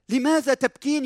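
high-pitched, challenging question; insistent reproach. Acted. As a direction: question her as if she should know. Acts out angels questioning Mary. (سؤال معاتب بنبرة مرتفعة)

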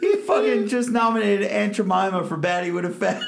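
high, loud laughing voice. Real, not acted. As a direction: talk through the laughter. Laughing at the nominee picture.

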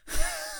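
short stifled snigger through the nose; a quick suppressed laugh. Shown as a demonstration. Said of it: "That's a snigger to me".

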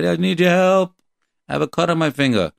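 mock plea, high and urgent; pleading cry for help. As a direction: act out a hurt child crying to God for help. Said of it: "you cry. Cry".

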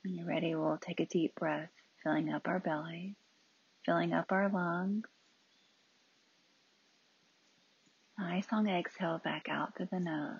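calm breathing cues with long silences; unhurried meditation leader; guided meditation. Real, not acted. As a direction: guide each breath, leaving room after it.

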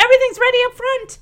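high, rapid excited chirp. Retold or imitated. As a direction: imitate a thrilled mother rushing the news. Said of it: "Nedra's so excited".

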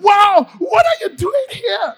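high, loud, slow awed shout; wonder. Shown as a demonstration. Preacher recalling first wonder at grace, awed shout. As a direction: marvel out loud, drawing it out. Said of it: "the wonder of".

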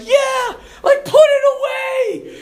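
high, loud terrified yell; frightened shout. Retold or imitated. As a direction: yell at the coach to stop. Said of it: "they're like".

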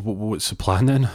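ordinary talk, nothing marked

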